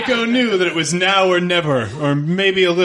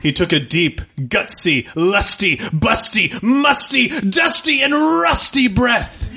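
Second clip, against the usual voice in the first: rising higher word by word to a shout; building from plain to shouting; 7 semitones higher than usual. Acted. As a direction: build each word to a yell. Escalating list rising to shout.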